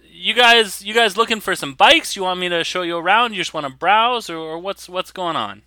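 high-pitched, lightly voiced shop owner; shopkeeper character. Shop owner asks what bikes they want, shopkeeper voice. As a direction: pitch it up, offering customers help.

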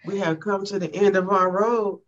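ordinary delivery

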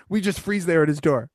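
ordinary talk, nothing marked

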